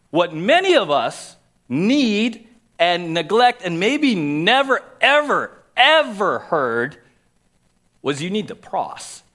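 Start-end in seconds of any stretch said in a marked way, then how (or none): 0.1-7.0 slow, loud, higher, stretching never ever ever; preaching emphasis. Acted. Pastor presses the neglected point on his congregation, intense. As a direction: build each ever higher and slower.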